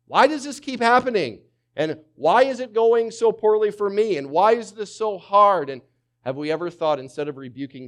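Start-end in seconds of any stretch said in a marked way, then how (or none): 0.1-5.7 high, loud frustrated cry of why me; anguished complaint. Retold or imitated. Preacher voicing a struggling believer, loud and high pitched. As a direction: cry out at life in mock despair. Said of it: "where you're like".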